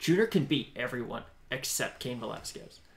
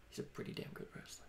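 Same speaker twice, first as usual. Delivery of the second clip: whispered; 17 dB quieter than usual. Real, not acted. Aside about wrestling.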